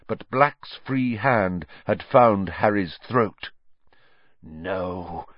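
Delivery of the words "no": low, soft hiss. A narrator's reading. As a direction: hiss it through the struggle. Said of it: "he hissed".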